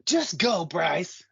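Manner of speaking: high, loud call up the trail; imitated yell. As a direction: yell it like a rider urging a friend on. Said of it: "I was, like, yelling".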